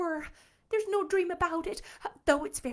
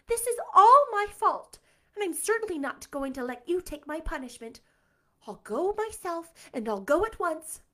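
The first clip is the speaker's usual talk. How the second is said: higher, louder, stressed ALL; exclaiming; 8 semitones higher, 7 dB louder than usual. Acted. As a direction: burst out in resolute dismay. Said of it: "exclaimed Anne".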